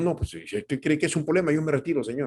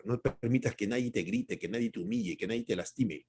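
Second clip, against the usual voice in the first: exhortación en voz baja; 5 semitones lower, 9 dB quieter than usual. Real, not acted. Exhortación íntima a la congregación.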